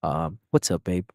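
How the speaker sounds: low, smooth mock boyfriend greeting. VTuber mocking boyfriend ASMR for chat. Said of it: "if I actually do this".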